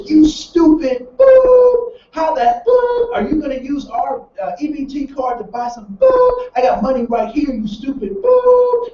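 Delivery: high, loud, drawn-out cussing at his partner; mock furious yell at her in line. Man in line cussing out his partner, loud mock yell. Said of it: "The guy turns around and he says".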